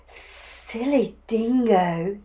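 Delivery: slow, sibilant reptile voice; scornful lizard hiss. A narrator's reading. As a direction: draw out the s sounds like a lizard. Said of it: "he hissed".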